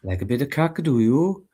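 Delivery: teaching the line in a mild Welsh accent. Shown as a demonstration. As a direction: say it with a light Welsh lilt, rising on cock. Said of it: "How do you say it?".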